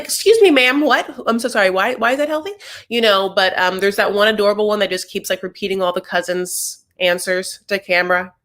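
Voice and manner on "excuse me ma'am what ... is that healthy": high-pitched, affronted mock disbelief; incredulous questioning. Producer grilling a child about candy as health food, incredulous. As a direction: pitch it up as a baffled interrogator.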